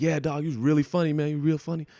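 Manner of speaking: high, quick hyped-up praise from a friend; excited buddy. Retold or imitated. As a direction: gush like a pumped-up buddy. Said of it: "his buddy was like".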